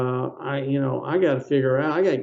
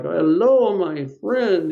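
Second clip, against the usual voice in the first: put-on higher, hearty Colombian-accented greeting; 5 semitones higher, 3 dB louder, much slower than usual. Nice older doctor greeting his patient, Colombian accent. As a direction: imitate a friendly Colombian doctor, pitched up. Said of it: "he had this Colombia accent".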